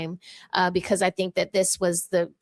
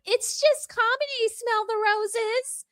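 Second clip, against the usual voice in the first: much higher, slow mocking singsong; mocking quote; 13 semitones higher than usual. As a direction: parody the smug excuse. Mocking the defenders' excuse.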